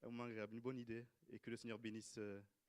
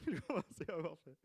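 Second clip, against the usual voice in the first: higher, louder, laughing repeat; amused at your own words; 5 semitones higher, 7 dB louder than usual. Real, not acted. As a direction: say it through a real laugh.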